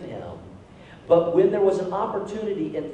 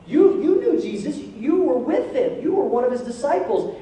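accusing recognition; 7 semitones higher, 4 dB louder than usual. Retold or imitated. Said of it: "somebody comes up and says".

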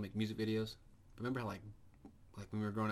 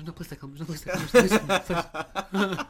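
rising, louder, high frantic begging; mock pleading; 8 semitones higher, 13 dB louder than usual. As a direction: beg louder and louder, hammed up. Acted begging traveler.

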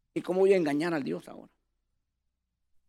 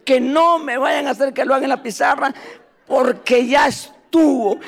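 panicked inner cry; 8 semitones higher, 7 dB louder than usual. Retold or imitated. Boy fears being exposed.

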